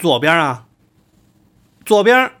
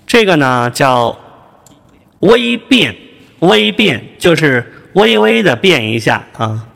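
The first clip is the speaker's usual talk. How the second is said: lightly altered, louder changer voice; just a touch of voice changing; slight voice change effect; 4 dB louder, slower than usual. Shown as a demonstration.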